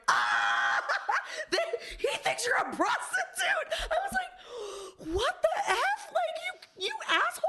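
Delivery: high, laughing mock shout; mocking laughter. Friends laughing at her being mistaken for a prostitute. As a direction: cackle it out high while mocking. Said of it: "the guys laugh so hard".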